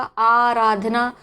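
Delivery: आराधना said very slowly, stretching the opening आ; long aa sound. Shown as a demonstration. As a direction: drag out the first आ of आराधना. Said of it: "sound आ रहा है बड़े आ का".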